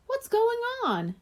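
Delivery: waking friend. A narrator's reading. Sleepy friend wakes up.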